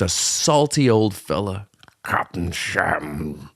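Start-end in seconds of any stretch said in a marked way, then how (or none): 2.0-3.5 put-on low, slow old sea captain; gruff old fisherman. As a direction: growl it like a salty old sailor. Salty old fella captain voice.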